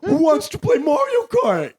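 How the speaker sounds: high, loud nasal nerd caricature; nerdy gamer voice. Acted. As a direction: squeal it like an overexcited nerd. Mocking a nerdy gamer host.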